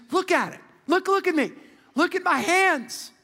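high-pitched, insistent calling out as Jesus; urgent acted appeal. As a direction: plead for them to look, pitched high. Acted Jesus urging disciples to look.

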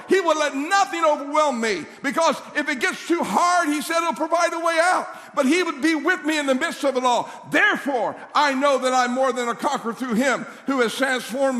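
high, driving preacher's cadence; full-force preaching. Real, not acted. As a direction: build scripture on scripture, urgently.

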